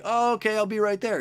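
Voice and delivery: high, chirpy agreeing voice; easily swayed Michelangelo. Retold or imitated. Michelangelo agreeing to leave his work, high and eager. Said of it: "he's like".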